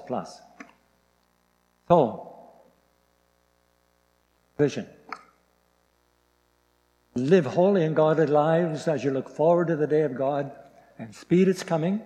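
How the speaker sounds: ordinary delivery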